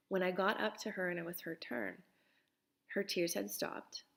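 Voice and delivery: ordinary delivery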